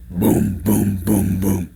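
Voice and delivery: deep, resonant booms; low giant hoofbeats, evenly thudded; huge heavy footsteps. Acted. Storyteller imitating big goat's steps, deep slow booms.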